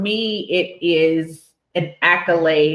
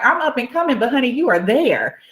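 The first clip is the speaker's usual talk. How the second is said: high, sing-out friendly insistence; emphatic praise; 8 semitones higher, faster than usual. Retold or imitated. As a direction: insist on their success, imitating an excited friend.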